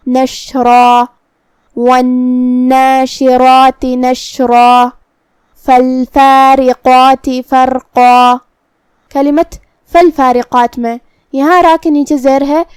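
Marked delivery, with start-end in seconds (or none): 0.1-4.9 slow chant, نشرا ending on a full dark "raa"; heavy final alif. Shown as a demonstration. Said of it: "مد الف بھی تفخیم". 5.6-8.3 slow tajweed chant, long level vowels; Quranic recitation. Shown as a demonstration. Recites verse as demonstration.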